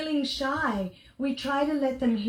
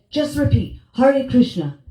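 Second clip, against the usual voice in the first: firm instruction; 8 dB louder than usual. Retold or imitated. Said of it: "She said".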